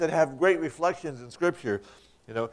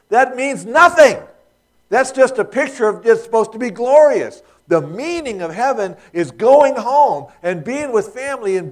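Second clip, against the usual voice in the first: rising, louder sermon push, pressing each point home; preaching at full force; 6 semitones higher, 6 dB louder than usual. Acted. Preacher to congregation, insisting heaven means family, louder, impassioned preaching. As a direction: build the sermon to a ringing peak.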